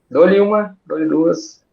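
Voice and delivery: loud high going once, going twice; auctioneer. Pretend. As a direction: call it out like an auction. Moderator jokes, going once, twice.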